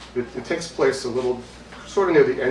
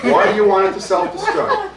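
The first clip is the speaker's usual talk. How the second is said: loud, high incredulous question; acted bewilderment with laughter around it; baffled question; 10 semitones higher, 8 dB louder than usual. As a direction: demand the reason, voice shooting up. Baffled question to eccentric musician.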